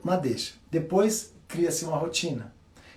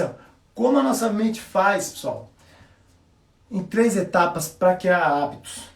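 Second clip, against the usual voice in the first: ordinary talk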